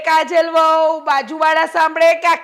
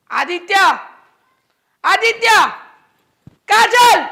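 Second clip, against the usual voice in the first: calling out; 5 semitones higher, slower than usual. Acted. Mother calls her nephew and daughter-in-law, shouting. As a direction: summon them across the house.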